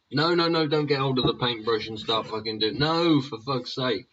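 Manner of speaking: high, loud nagging no no no; loud scolding woman. Retold or imitated. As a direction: shout it like a nagging mum. Acting a woman stopping someone.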